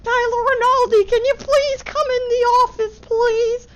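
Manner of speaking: very high, sing-song school monitor voice; high lady-monitor impression; imitating hall monitor. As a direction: mimic the monitor calling his name over-politely. Monitor calls him to office.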